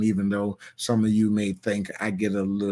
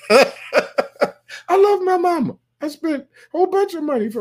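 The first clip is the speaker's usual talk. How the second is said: laughing; 6 dB louder than usual. Real, not acted. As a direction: laugh through it while insisting. Laughing while defending his mother.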